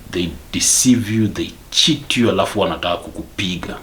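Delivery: usual speaking voice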